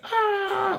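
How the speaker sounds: a short yelp of Superman's silent scream; mock scream.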